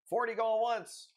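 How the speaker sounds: high-pitched auction call; auctioneer's call. Pretend. Calling a bid in live sale.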